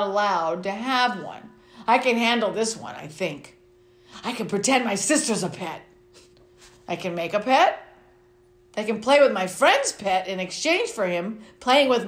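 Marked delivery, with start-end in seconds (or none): none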